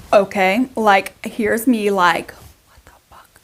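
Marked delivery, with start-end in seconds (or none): 2.3-3.4 barely audible stunned whisper; whispered disbelief. Retold or imitated. Mom reacting to her son's bizarre claim, whispered disbelief. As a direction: whisper it, as if under your breath.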